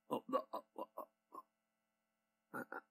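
quiet, halting fumble for an answer; flustered stammering. Acted. Gossiper asked if they went to that person, flustered stammering. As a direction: stammer softly as if caught out.

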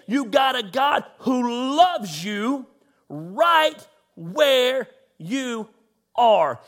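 very slow, drawn-out right where you are; emphatic slow preaching. Real, not acted. Pastor telling online viewers God loves them, slow and emphatic. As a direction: stretch each word to land it.

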